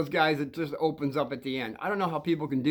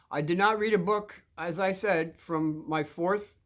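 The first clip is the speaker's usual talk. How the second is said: ordinary delivery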